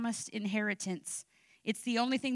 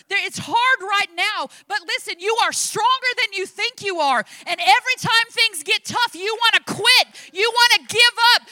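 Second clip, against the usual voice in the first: loud, rising sermon exhortation; preaching at full force; 6 semitones higher, 11 dB louder than usual. Acted. Pastor urging the congregation not to give up, preaching loud and fervent. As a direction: drive it home to the congregation.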